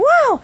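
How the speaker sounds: high yelp; involuntary burst mid-story; vocal tic whoa. Real, not acted. Tics still coming out as she talks, high yelp. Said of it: "They were still coming out".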